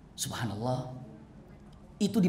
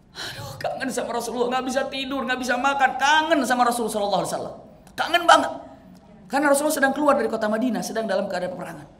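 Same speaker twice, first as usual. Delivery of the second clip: high, pleading voice of a man aching with longing; longing companion; 7 semitones higher, 3 dB louder, faster than usual. Acted. Preacher voicing Thauban missing the Prophet, high and aching. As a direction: cry out your longing, higher and faster.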